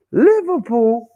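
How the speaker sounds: Liverpool called out high and bright; hyped team call; excited announcement. Real, not acted. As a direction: announce the club with a lift. Announcing team name dramatically.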